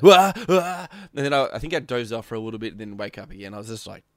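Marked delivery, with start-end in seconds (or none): 0.0-0.9 louder, higher mock groan of waking; groggy waking noise. Pretending to come round in the car, mock groggy groan. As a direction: fake being dazed awake. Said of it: "And I was like".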